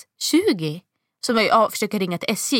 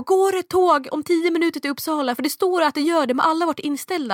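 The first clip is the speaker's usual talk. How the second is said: desperate pleading; 8 semitones higher than usual. Retold or imitated. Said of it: "jag bara".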